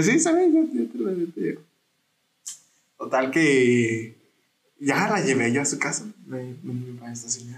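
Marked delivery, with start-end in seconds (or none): 0.0-1.6 mock self.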